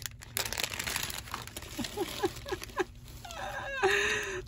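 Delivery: high, crying laugh of delight; overwhelmed teary giggle; tearful laugh. Real, not acted. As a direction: laugh until it turns to happy tears.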